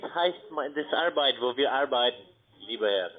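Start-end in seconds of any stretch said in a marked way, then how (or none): none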